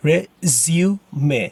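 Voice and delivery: "Resume" as re-zyoo-may in separate beats; syllable by syllable. Shown as a demonstration. Teacher breaking it down for learners, syllable by syllable. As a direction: sound out the final "may" of "Resume". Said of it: "American is pronounced".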